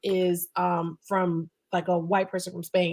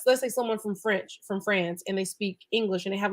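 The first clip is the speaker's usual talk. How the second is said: ordinary talk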